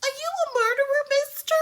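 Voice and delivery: put-on high, quick, childlike wide-eyed question; high little-girl voice asking; scared little girl. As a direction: play the timid kid in the back seat. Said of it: "her poor daughter ... be like".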